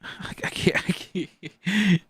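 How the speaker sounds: real helpless laughter through the words; cracking up mid-sentence; laughing too hard. Real, not acted. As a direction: barely get it out for laughing. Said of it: "I was laughing too hard".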